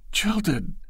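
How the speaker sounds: high, startled repeat; shocked echo. Acted. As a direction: Loretta echoing Bashford's harsh word in shock.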